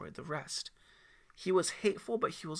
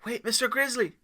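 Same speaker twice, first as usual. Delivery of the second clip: put-on high, louder kid calling out; child voice; 9 semitones higher, 9 dB louder than usual. Aiden calls out to the mountain man, high kid voice. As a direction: call out to him like an eager boy.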